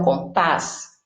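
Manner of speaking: compás with the weight on PÁS; stress on last syllable. Shown as a demonstration. Teacher explaining rule three to pupils, compás stressed on PÁS. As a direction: make the final syllable of compás the strong one. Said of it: "ele é a sílaba mais forte".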